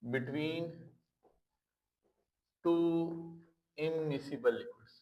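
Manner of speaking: word by word, with long pauses between; slow dictation. Real, not acted. Teacher writing out the definition for students, slow dictation. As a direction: dictate it very slowly, leaving time to write.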